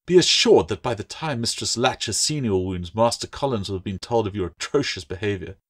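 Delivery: usual speaking voice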